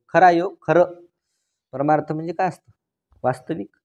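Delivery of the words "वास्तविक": usual speaking voice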